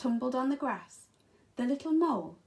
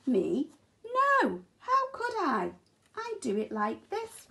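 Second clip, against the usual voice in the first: high, louder indignant denial; pig's voice; 5 semitones higher, 3 dB louder than usual. Acted. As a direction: squeal the protest like an offended pig. Pig answers the mole.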